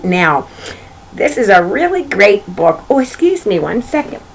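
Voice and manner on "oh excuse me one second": ordinary delivery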